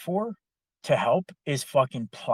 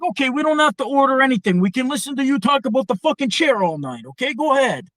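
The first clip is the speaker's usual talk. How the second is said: much higher, louder fast mock tirade; imitated angry rant; 12 semitones higher, 7 dB louder, faster than usual. Italian co-worker berating the nonstop talker, loud mocking rant. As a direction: pile on the sarcasm at him. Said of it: "he finally lost it on Ron".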